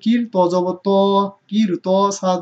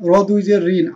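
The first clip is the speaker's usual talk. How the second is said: ordinary delivery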